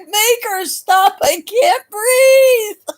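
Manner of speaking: high, slow, laughing plea; mock breathless laughter. Audience member begging her to stop, high laughing cry. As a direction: beg through helpless laughter.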